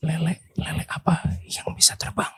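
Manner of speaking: whispering. Real, not acted. Whispered riddle to the group.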